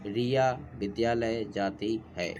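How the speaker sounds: slow 'रिया विद्यालय जाती है' split into separate sounds. Shown as a demonstration. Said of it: "किस प्रकार से टुकड़ा टुकड़ा किया हुआ है". ('रिया विद्यालय जाती है' धीरे-धीरे, ध्वनि-ध्वनि अलग करके)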